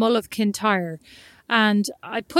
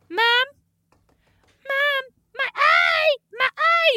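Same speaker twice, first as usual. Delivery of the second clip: put-on kid crying out; slower than usual. Doesn't sound really sinister yet.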